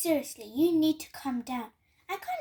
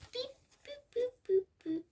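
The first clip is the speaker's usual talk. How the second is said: till beeps; 8 semitones higher than usual. Acted. Imitating the till.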